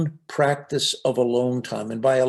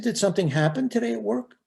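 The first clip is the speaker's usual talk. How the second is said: voicing the wife; 8 semitones higher, faster than usual. Voicing wife's concerned question.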